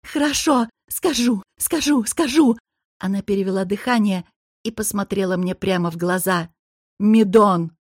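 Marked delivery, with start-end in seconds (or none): 0.0-2.6 higher, strained cries; screaming in pain. A narrator's reading. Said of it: "и женщина закричала". 7.0-7.7 shouted name. Acted. Forced confession, shouted.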